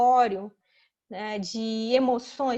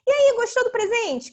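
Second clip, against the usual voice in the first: put-on higher, louder cheery question to a kid; adult asking child; 10 semitones higher, 8 dB louder than usual. Adult asks the child about a present, high-pitched and eager. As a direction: ask it high and loud, as to a small child. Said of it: "Aí pergunta pra criança".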